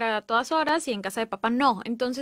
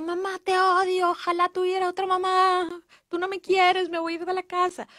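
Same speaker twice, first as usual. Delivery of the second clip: put-on pitched higher, a child's tantrum voice; upset child; 5 semitones higher than usual. Child in crisis shouting at mom, mock upset. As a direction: play the hurt kid lashing out at mom.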